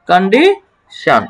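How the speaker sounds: Condition drawn out slowly with শান at the end; slow model pronunciation. Shown as a demonstration. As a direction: stretch condition syllable by syllable. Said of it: "কিন্তু এখানে হবে কি? শান।". (ধীরে টেনে উচ্চারণ)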